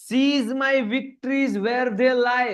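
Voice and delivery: very slow, high, stretched reading of the line; drawn-out dramatic verse reading; slow poem recitation. Shown as a demonstration. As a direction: read each word out like a poem.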